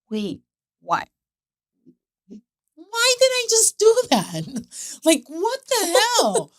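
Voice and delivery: high, loud outburst of disbelief; shocked disbelief. Retold or imitated. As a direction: suddenly snap out of it, stunned. Mère stupéfaite au supermarché.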